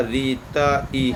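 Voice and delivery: री, त, ई each said separately, very slowly, as the letter breakdown of कृति; a teacher spelling out री त ई sound by sound; syllable by syllable. Shown as a demonstration. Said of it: "कृति का सही विश्लेषण होगा".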